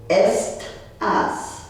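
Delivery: "estás" broken in two with a gap, est - ás; divided syllables. Shown as a demonstration. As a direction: split "estás" slowly into est, pause, ás. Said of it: "because the word divides".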